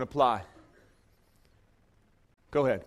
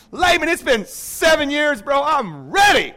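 eager declaration; 9 semitones higher, 7 dB louder, slower than usual. Acted. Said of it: "he's like".

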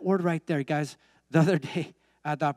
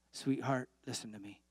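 much softer, lower, gentle voice; tender reassurance; 3 semitones lower, 10 dB quieter than usual. Retold or imitated. Preacher retelling how he consoled a crying lady, soft and tender. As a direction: hush down to comfort her. Comforting a tearful woman, retold.

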